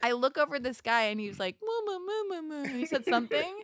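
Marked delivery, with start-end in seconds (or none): 1.6-2.6 silly mooing. Retold or imitated. Imitating guy's silly noise.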